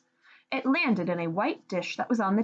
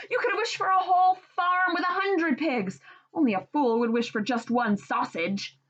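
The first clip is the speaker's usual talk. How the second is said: scolding; 8 semitones higher than usual. Acted. Wife scolding husband.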